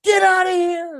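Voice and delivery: high, loud mock yell; startled outburst, high and loud; shout. Retelling the shout at the stranger's car.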